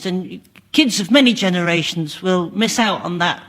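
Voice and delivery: ordinary talk, nothing marked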